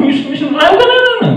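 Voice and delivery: high squealing mock tantrum cries. Preacher acting out a child's floor tantrum, high mock wails. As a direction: ham up a child rolling on the floor.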